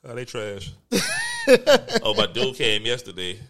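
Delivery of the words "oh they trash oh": ordinary talk, nothing marked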